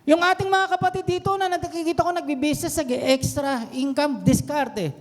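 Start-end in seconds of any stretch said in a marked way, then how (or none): none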